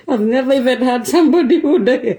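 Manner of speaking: talking through a real laugh; laughing at his own joke while speaking; words broken by laughter. Real, not acted.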